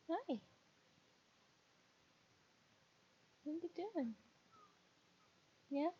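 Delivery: high sing-song voice for a pet. Real, not acted. Streamer cooing at the cat in high baby talk. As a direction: coo up high at the kitty.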